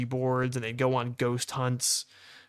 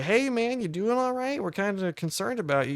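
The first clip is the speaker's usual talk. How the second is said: concerned check-in; 8 semitones higher than usual. Retold or imitated. Checking on a dazed roommate.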